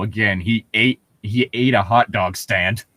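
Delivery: ordinary delivery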